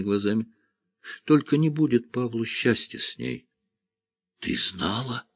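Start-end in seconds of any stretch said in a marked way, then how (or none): 3.9-5.2 slow, stunned gasp of a question; ахнув. A narrator's reading. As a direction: breathe it out in shock. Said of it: "ахнул он". (изумлённо, на выдохе)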